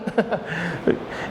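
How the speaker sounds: soft, low chuckle; laugh. Real, not acted. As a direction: chuckle quietly at your own story. Amused by own retold scene. (risa suave)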